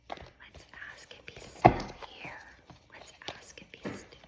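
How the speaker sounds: hushed secret whisper. Real, not acted. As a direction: whisper so nobody hears. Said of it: "I feel like I have to whisper".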